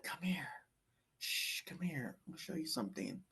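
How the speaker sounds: soft, hushed calling voice; a gentle hushed summons from God; quiet, secretive beckon. Retold or imitated. God calls him in a vision.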